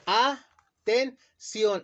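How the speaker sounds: "Atención" split very slowly as a-ten-ción; "Atención" broken very slowly into its syllables, a-ten-ción; slow syllabified. Shown as a demonstration. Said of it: "¿Cómo dividimos la palabra atención?".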